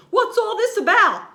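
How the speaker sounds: high loud call; crowd shouting. A narrator's reading. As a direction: shout the question across the crowd. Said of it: "the people came shouting".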